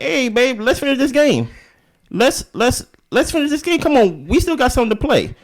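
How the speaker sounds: coaxing husband. Retold or imitated. Voicing Courtney coaxing his wife.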